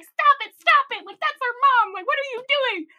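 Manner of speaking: high loud mock shouting; retold yell. Kids shout at pepper-spraying lady to stop, loud.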